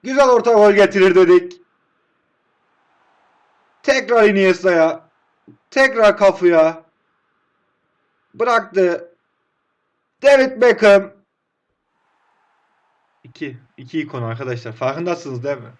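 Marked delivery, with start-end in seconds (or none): 0.0-11.1 louder, building commentary; louder excited match call; excited play-by-play. Real, not acted. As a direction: call the attack like a live commentator. Calls the attack loudly.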